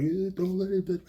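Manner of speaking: high, loud pleading cry; desperate cry. Retold or imitated. Grieving son grabs the doctor and cries out, high and loud. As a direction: cry out as you grab at him.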